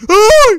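loud, high holler of ah; sudden yell at a big play; wordless high shout; high excited scream. Real, not acted. Reacting to a dunk.

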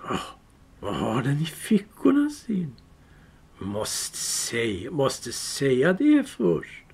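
suspicious creature drawing out words; Gollum hissing. Acted. Gollum suspecting Bilbo's pockets, slow sly hiss.

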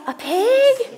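mock gasp of delight; surprised. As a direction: act amazed for the kids.

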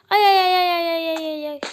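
startled yelps, fading; alarmed cries. Real, not acted. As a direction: yelp in alarm as you hit it by mistake. Accidentally hit the horse.

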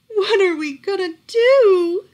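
high, loud, slow cry of despair. A narrator's reading. Miss Cream cries out in despair over her daughter, high and tearful. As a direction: wail it, close to tears. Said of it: "cried Miss Cream".

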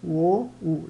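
usual speaking voice

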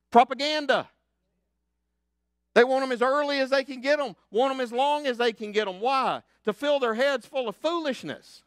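loud, high, fervent preaching; preaching at full force. Acted. Pastor rallying his church, preaching loud and high. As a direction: lift them up with the message.

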